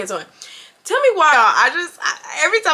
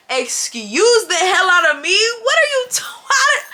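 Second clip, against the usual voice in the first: incredulous outburst; 6 semitones higher than usual. Retold or imitated. Woman reacting to her date's anger confession, incredulous outburst. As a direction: pitch climbing into a squeal.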